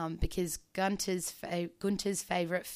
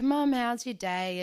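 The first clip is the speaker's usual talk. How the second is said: higher, louder, slow cheery hello; bright greeting; 6 semitones higher, 9 dB louder, slower than usual. Retold or imitated. Calling mum on video, louder cheery greeting.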